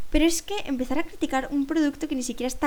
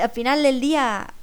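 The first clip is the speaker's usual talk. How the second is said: normal talking voice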